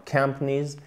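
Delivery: "companies" with strong start and swallowed middle; first-syllable stress. Shown as a demonstration. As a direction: say "companies" softly as "KUMP-neez". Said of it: "accent tonique sur la première syllabe".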